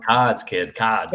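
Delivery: put-on teasing Boston accent, no r; broad Boston ah in cods; imitated Boston accent. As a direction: mimic a Bostonian's dropped r. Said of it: "my accent".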